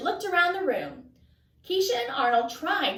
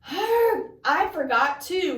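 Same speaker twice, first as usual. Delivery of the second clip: groaning; 3 semitones higher, 3 dB louder, slower than usual. Acted. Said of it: "Arnold groaned".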